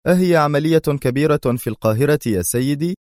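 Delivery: ordinary delivery, nothing marked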